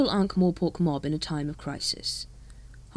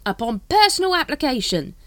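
high, loud cockney-ish hawker; street hawker voice; 9 semitones higher, 6 dB louder than usual. Acted. Dibbler greets the Captain, high hawker voice. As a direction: sidle up like a spiv.

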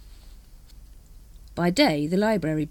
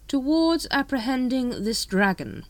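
slow, firm and raised in pitch; 8 semitones higher, slower than usual. A narrator's reading. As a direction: spell it out firmly for a subordinate. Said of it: "said the patrician firmly".

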